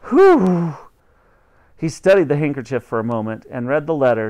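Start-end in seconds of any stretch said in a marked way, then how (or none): 0.1-0.7 loud, high hoot for nose-blowing; imitated nose-blow. Boy blows his nose into the handkerchief, imitated nose-blow. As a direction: voice a big honking nose-blow. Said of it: "Then he blew his nose".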